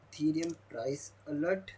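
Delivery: usual speaking voice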